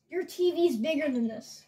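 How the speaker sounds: ordinary delivery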